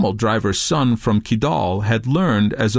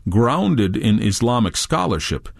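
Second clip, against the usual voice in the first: ordinary delivery, nothing marked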